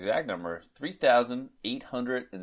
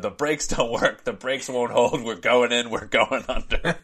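loud, higher alarmed yell; panicked shout; 4 semitones higher, 6 dB louder than usual. Retold or imitated. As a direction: cry out that the brakes failed. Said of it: "he shouted".

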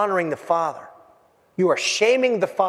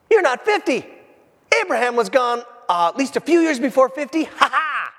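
gloating taunt; 7 semitones higher than usual. Acted. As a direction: crow over a caught opponent. Opponents gloating over Jesus.

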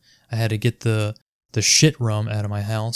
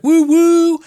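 high, loud "woo-woo" cheer; crowd cheer; 8 dB louder than usual. Retold or imitated. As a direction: whoop it up like a bar crowd. Bikers cheering in the bar.

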